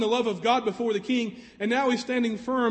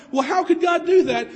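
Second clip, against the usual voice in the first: raised, louder mock protest; indignant quote; 6 semitones higher, 7 dB louder than usual. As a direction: throw out the doubter's objection. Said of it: "when we hear".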